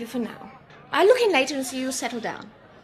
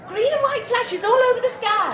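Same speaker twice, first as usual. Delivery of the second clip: bright and thrilled, pitched up and louder; excited; 8 semitones higher, 6 dB louder than usual. Acted. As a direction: gush about the lights like a delighted child. Nurse marvelling at the sky.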